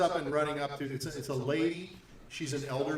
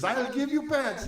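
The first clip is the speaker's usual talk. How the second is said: mock pleading woman; 12 semitones higher, 6 dB louder than usual. Mary offers pants, joking.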